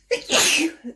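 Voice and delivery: dry real cough. Real, not acted. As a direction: cough into the mic. Reader coughing during reading.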